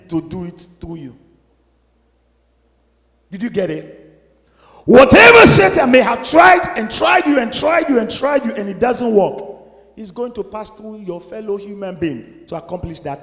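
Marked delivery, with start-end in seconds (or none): 4.8-8.4 high, loud sermon cry; preaching shout. Real, not acted. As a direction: drive the point home at full force.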